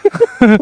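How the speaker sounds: high, loud burst of laughter; loud, high-pitched cackling laugh; sudden laugh. Real, not acted.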